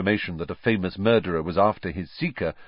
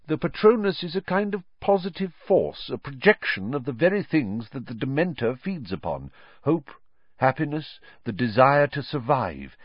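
lightly voiced teacher, higher-pitched and emphatic; Lupin, earnest teacher; 6 semitones higher than usual. A narrator's reading. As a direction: explain it earnestly, item by item. Voices Lupin explaining the Patronus.

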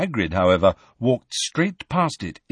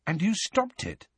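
much higher, softened wondering question; amazed and impressed; 10 semitones higher, 5 dB quieter than usual. A narrator's reading. Harry learns Hagrid broke up the fight, amazed. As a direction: ask it full of awe. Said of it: "amazed and impressed".

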